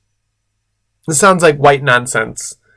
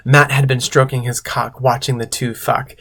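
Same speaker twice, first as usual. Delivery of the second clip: normal talking voice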